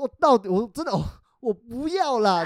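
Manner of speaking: put-on embarrassed girlfriend refusing on the stairs; whiny protest. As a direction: protest sweetly, half-crying. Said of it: "他就说".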